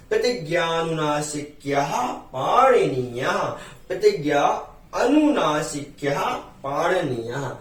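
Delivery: slow, drawn-out Sanskrit recitation. Shown as a demonstration. Teacher quoting Panini's line to students, slow recitation. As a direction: recite it deliberately, stretching each word.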